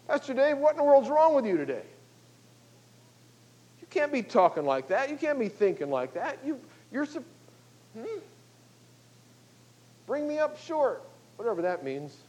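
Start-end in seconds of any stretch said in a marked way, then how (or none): 0.1-11.0 higher-pitched mock rebuke of the pastor; scolding church member. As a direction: call the pastor out, half joking. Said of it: "I will expect you to say".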